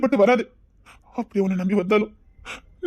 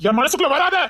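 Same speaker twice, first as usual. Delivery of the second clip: higher, urgent repeat of the plea; rising plea; 5 semitones higher than usual. Acted. Torn girl begs him aloud, higher and urgent. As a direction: lift it into open begging.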